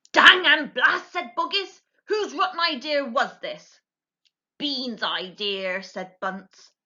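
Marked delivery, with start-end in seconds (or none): 0.1-3.8 cross farmer. Acted. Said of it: "very tired and very cross". 4.6-5.8 grumbling farmer. Acted. Farmer Bunce answers.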